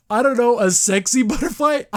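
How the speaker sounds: high, loud, breaking into laughter; amused bafflement. Real, not acted. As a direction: giggle through an awkward description. Amused by an odd illusion.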